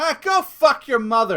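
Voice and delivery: loud, high burst of abuse; retold angry shout. Driver cursing back at angry father, retold shout. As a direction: fire the insult back loudly.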